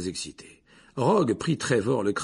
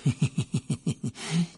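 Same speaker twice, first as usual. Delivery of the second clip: short malicious snicker; a mean little laugh; 5 semitones higher than usual. Acted. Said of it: "un petit ricanement". (petit ricanement mauvais)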